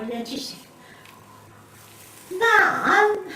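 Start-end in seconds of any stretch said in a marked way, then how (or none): 2.3-3.3 much higher, louder whine; pleading call. Retold or imitated. Daughter pleading with her mother, high and insistent. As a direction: draw out the plea for attention.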